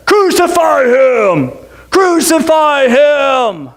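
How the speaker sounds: long, drawn-out mob shout. Acted. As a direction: bellow it slowly as the mob. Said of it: "so then they shouted louder".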